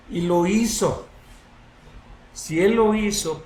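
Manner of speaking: ordinary talk, nothing marked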